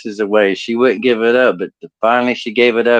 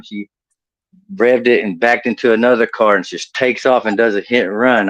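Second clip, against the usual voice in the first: ordinary talk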